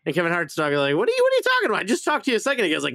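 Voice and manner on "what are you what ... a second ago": high-pitched, incredulous dog character; mock-baffled squawk, pitched high; acted indignant protest. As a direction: protest in a voice that jumps way up.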